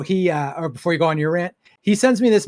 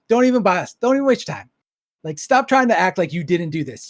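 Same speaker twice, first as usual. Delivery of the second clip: normal talking voice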